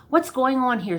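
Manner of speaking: high, loud frustrated yell; an exasperated outburst; shouted. Acted. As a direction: demand an answer from the fortune teller. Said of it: "shouted the young man".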